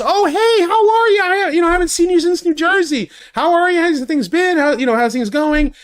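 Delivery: high sing-song friendly impression; chirpy greeting. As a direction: imitate her cheery rapid catch-up greeting. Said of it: "She goes".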